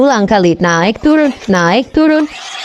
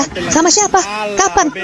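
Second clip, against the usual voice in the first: much higher, shocked angry questions; angry shout; 10 semitones higher, 4 dB quieter than usual. Acted. Husband on the phone yelling at his crying wife, angry. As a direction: fire the questions at her. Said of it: "teriak suaminya marah".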